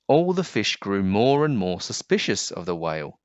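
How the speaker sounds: ordinary delivery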